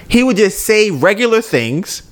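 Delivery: ordinary talk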